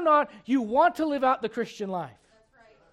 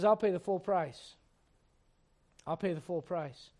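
quiet resolve; 8 semitones lower, 10 dB quieter than usual. Retold or imitated. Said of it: "David says".

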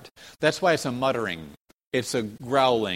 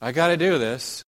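put-on reluctant complaint, lightly acted; mock complaining. Preacher mimics deceivers grudging their daily task, mildly complaining. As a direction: imitate someone grumbling about a daily chore. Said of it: "they're not complaining".

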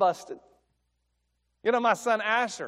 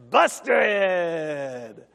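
drawn-out punchline, a touch louder; busted punchline; 4 dB louder than usual. Acted. Preacher delivering the punchline to the congregation, drawn out. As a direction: land the word like a verdict.

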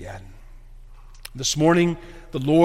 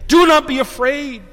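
raised, louder, firm command "Do not be afraid"; emphatic; 10 semitones higher, 7 dB louder than usual. Acted. God to his fearful people through angels, emphatic. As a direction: proclaim "Do not be afraid" high and loud. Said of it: "is very emphatic here".

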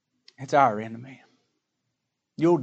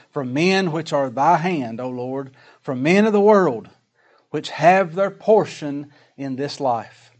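slow, loud, raised scripture reading; emphatic scripture reading; 5 dB louder, slower than usual. Acted. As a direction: read the verse out slowly and forcefully. Reads scripture aloud with emphasis.

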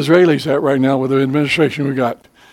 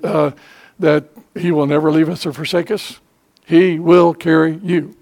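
ordinary talk

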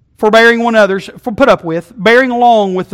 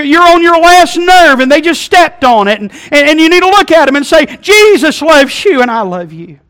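higher, louder, impassioned sermon; full-force preaching; 9 semitones higher, 10 dB louder than usual. Acted. Preacher pleading with the congregation at full force. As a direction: preach it hard to the back row.

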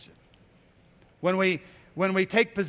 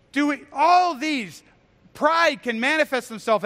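preaching at full force; 7 semitones higher, 7 dB louder than usual. Acted. Preacher challenging the congregation on love, preaching hard. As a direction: hammer each question home from the pulpit.

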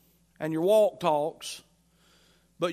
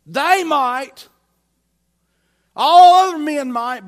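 loud, high boast voiced for Peter; proud proclamation; 6 semitones higher, 13 dB louder, slower than usual. Preacher acting Peter professing loyalty before all the disciples, loud proclamation. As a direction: proclaim it loudly, pitch lifted. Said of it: "Peter had professed and proclaimed".

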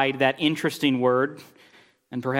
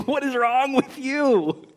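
exasperated; 10 semitones higher, slower than usual. Real, not acted. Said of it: "you have to restrain this".

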